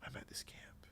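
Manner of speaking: soft breathy secret; whispered confession. Retold or imitated. [name] retelling a girl at camp, whispering softly. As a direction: hush it like a confession. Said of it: "She goes".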